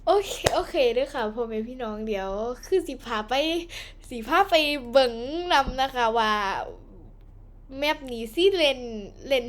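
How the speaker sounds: chatty Isan dialect host talk. Acted. Host greeting viewers and starting the game, in Isan dialect. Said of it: "เริ่มพูดอีสานเลยปะ".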